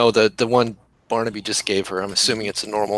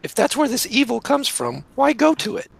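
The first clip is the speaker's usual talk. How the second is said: higher-voiced, protesting farm girl; Celia; 13 semitones higher than usual. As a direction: object to the trip, pitched up and insistent. Game master plays farm girl Celia.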